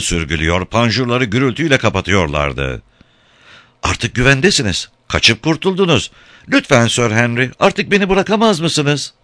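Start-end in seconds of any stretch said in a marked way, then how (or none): 3.8-9.1 high-pitched pleading; begging. A narrator's reading. Katinka begging Sir Henry for her life, pleading. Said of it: "hayatı için yalvaracak kadar".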